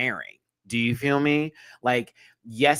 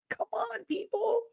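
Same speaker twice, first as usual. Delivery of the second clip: high exasperated cry; 7 dB quieter than usual. Real, not acted.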